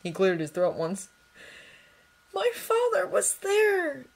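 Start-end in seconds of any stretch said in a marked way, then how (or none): 2.3-4.0 high, slow, breathy fear; boy confessing his father's return, breathless; breathed confession. Acted. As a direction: breathe it out in dread. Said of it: "Draco breathed".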